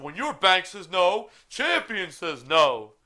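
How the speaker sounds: slow, high ad slogan voice; commercial jingle. Retold or imitated. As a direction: pitch it like a TV ad slogan. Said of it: "on the commercial".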